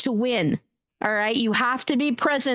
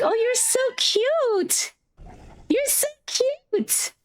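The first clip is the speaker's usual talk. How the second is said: very high, drawn-out gushing; cooing; 12 semitones higher, slower than usual. Real, not acted. Streamer adoring another player's cute noise, high slow coo. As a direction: stretch it into a squeaky coo of adoration.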